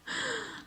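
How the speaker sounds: soft, high-pitched giggle; self-conscious laugh. Real, not acted. Author admits unease about saying a word, self-conscious giggle. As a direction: giggle quietly at yourself.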